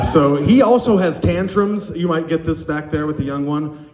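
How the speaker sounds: normal talking voice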